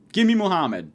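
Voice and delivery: usual speaking voice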